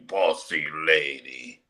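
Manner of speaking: low, slow quote; Scarface impression. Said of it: "when he said".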